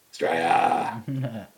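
slow, drawn-out Australian "Australia"; Aussie drawl. Shown as a demonstration. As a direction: stretch "Australia" out like a broad Australian. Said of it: "that's how you say it".

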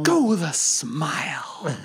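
whispered, slow Joker line; Joker impression. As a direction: breathe it like the Joker. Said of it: "Can't do him".

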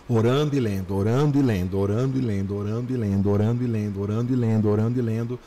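low, even, rolling repetition; repetitive routine. Retold or imitated. Repeats his year-long routine. (repetição contínua em voz mais grave)

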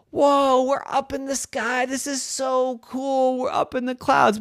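high, wondering whoa; amazed boy. Acted. Boy in flight for the first time, amazed. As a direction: gasp at the clouds in awe.

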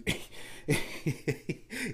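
high, breathy giggling laugh; real wheezy laugh; laughter. Real, not acted. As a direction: break off talking and crack up. Laughing at the new dudes.

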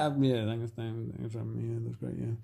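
imitating a kid forced to say thanks; mumbled reluctant thanks. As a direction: mumble it out, embarrassed. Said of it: "And she's like".

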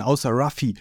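ordinary delivery, nothing marked

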